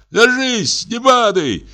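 put-on high slipping drunk's cry; shouting. Drunk student slipping in the snow, high shout. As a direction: shout it while losing your footing. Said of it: "крикнул".

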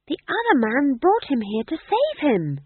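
high, loud insistent shout. A narrator's reading. Magrat shouting at the other witches, high and loud. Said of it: "shouted Maigrat".

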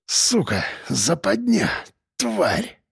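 soft hoarse cursing, rising. A narrator's reading. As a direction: rasp out the curses. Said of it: "прохрипел я".

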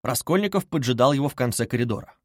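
plain reading voice

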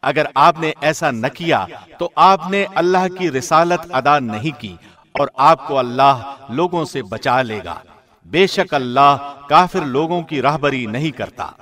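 normal talking voice

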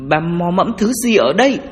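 loud, high, nervous challenge; alarmed demand. Acted. Grave robber asking what the woman gropes for, alarmed demand. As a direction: raise the voice in alarmed questioning.

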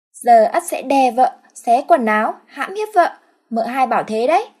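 put-on high, loud childish man voice; high childlike husband's voice; simpleton husband. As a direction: play a dim-witted brute. Ất announces his intent.

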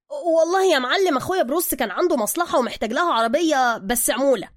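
butter up the boss; flattering Soka. Acted.

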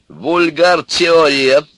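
loud, high, drawn-out dismissal; cutting in. A narrator's reading. Old man interrupts Ivan's theory, dismissive. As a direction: interrupt with lofty scorn. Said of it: "прервал старичок Ивана".

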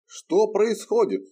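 high, slow demanding shout; stern demand. Acted. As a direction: demand an answer from above. Knight demands answers.

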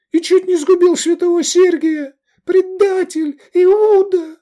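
very high, loud denunciation; shrill-pitched, impassioned girl's tirade; girl's accusing cry. A narrator's reading. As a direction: cry out the accusation before the crowd. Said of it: "крикнула девушка".

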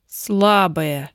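scornful, accusing bark; contemptuous accusation. Acted. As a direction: spit it out with contempt. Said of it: "Фраза прозвучала как обвинение".

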